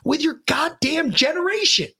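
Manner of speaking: high-pitched, impassioned retold rant; pissed-off tirade. Dad rants at son about his generation, pissed-off tirade. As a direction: re-enact a furious lecture, drawing out key words. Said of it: "went into another tirade because I was fucking pissed".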